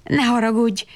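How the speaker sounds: ordinary delivery, nothing marked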